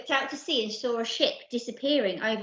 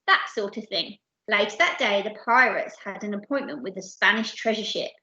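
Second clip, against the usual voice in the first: ordinary delivery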